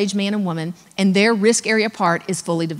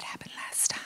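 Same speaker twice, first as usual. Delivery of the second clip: whispered aside; 17 dB quieter than usual. Retold or imitated. Risk manager hinting at the incident, whispered. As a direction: whisper it under the breath. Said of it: "she's like".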